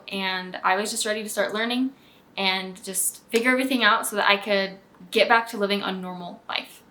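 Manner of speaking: ordinary talk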